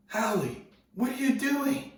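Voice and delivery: very high, loud, disbelieving holler; incredulous local. Retold or imitated. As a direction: call out in mock disbelief. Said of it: "dives in and pops up laughing".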